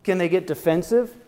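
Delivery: ordinary delivery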